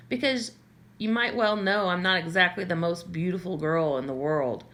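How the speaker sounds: ordinary delivery, nothing marked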